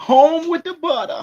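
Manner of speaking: high, loud Southern twang; put-on Southern accent. Co-host echoing the butter slogan, mock Southern accent.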